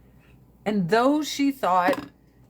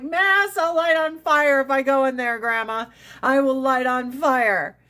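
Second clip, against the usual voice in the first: high, loud, incredulous protest; mock horror at being dragged to church; indignant protest; 4 semitones higher, 4 dB louder than usual. Protesting grandma's funeral mass demand.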